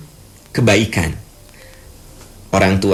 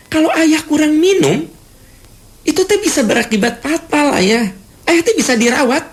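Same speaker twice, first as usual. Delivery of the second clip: much higher, insistent telling-off; scolding lecture. Acted. Child lecturing father about drinking enough water, high scolding. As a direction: lecture dad as if he were the child. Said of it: "maksudnya kan baik".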